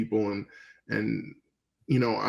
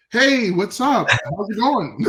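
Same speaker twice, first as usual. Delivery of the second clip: suddenly bright and much higher, louder; perked up; 10 semitones higher, 5 dB louder, slower than usual. Retold or imitated. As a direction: snap awake and sound eager. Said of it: "So it perked me up".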